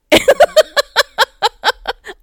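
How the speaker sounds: loud, high burst of laughter; laughing. Real, not acted. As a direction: crack up at your own folly. Laughs at own sewing.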